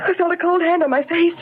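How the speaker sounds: put-on a frightened girl telling her companion what touched her. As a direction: blurt it out in fright. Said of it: "You're frightened".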